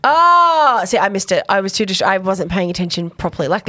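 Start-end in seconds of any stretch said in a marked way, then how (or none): 0.0-0.8 realization. Real, not acted.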